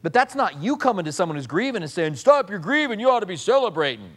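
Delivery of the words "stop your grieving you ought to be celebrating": mock bossy comforter. Mocking a pushy comforter.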